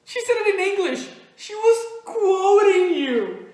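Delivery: very high, loud, emphatic; exasperated accusation. Retold or imitated. Teller proving who said it, loudly exasperated. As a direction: nail the friend with proof. Triumphant proof against the friend.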